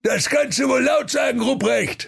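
hammed-up furious, high-pitched bellow; Weihnachtsmann. A narrator's reading. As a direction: holler it high in indignation. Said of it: "sprach der Weihnachtsmann".

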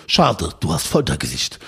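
deep mock domina verdict; stern domina voice. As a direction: play the cold dominatrix sizing him up. Domina voiced in retold story.

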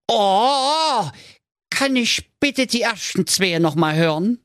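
put-on high-pitched fretful young prince; a pampered prince in a panic; whiny high prince. Prince dismayed. (hohe, weinerliche Prinzenstimme)

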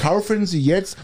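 mock Irish-accented ad pitch; chirpy sales voice with a put-on accent; chipper 1950s ad. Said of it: "mit diesem scheiß Dialekt noch".

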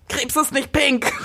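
höher, langsamer Protestruf nachgemacht; leicht gespielter wütender Ausruf; geschrien. Retold or imitated. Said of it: "durch die Straßen gehen und schreien". (wie ein Protestruf, nur angedeutet)

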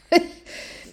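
quick high laugh burst; high breathy laugh. Real, not acted. Coach catching her own outburst, high laugh. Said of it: "ich flippe schon wieder ein bisschen aus".

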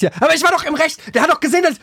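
deutlich höher, empört gerechtfertigt; trotzig rechtfertigend. Retold or imitated. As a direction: beleidigt auf sein Recht pochen. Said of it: "dann hab ich gesagt". (leicht gespielte Empörung, viel höher)

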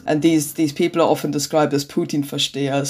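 usual speaking voice

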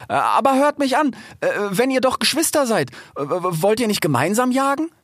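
high, stammering plea; nervous fox. A narrator's reading. Trapped fox proposes joint hunting, high stammering plea. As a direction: bargain anxiously in a high voice.

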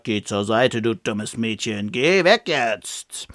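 a slow, threatening dark lord voice; cold villain voice. Retold or imitated. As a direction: drag it out coldly, like a dark wizard. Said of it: "eine andere Stimme".

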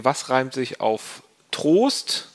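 usual speaking voice

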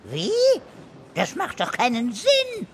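ordinary delivery

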